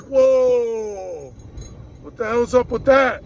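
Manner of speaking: mock-outraged customer reaction; loud, stretched-out whoa of protest; indignant disbelief. Quoting outraged well-water customers.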